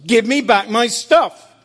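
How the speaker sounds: loud firm demand. Retold or imitated. Jesus insisting to Graham, loud firm demand. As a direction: insist without budging.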